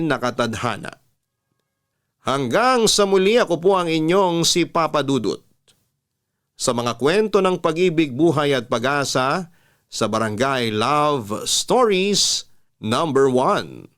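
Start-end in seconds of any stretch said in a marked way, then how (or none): 2.3-13.9 bright, upbeat announcer close, stretching out the show name slowly; radio host sign-off. Acted. Papa Dudut signing off to his listeners, announcer style. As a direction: sell the show's name like a DJ.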